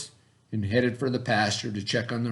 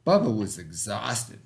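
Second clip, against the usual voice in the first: plain reading voice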